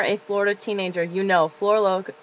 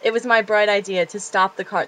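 normal talking voice

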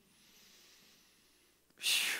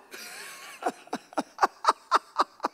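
high, loud burst of laughter; big laugh; 9 dB louder than usual. Real, not acted. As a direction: laugh out loud at your own joke. Laughs at own joke.

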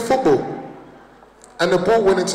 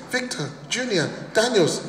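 mum calling out; 4 semitones higher, 7 dB quieter than usual. Retold or imitated. Nigerian mum calling her son home, calling voice. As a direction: call the child in like a mother. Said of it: "my mum will start calling me".

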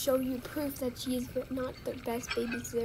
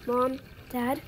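usual speaking voice

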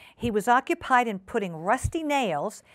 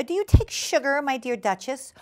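higher, lightly voiced polite hostess; genteel hostess Ribby; 6 semitones higher than usual. Ribby offering her guest sugar, polite hostess voice.

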